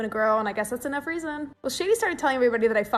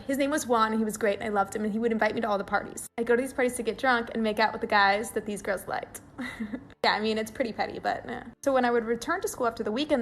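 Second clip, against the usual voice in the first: ordinary delivery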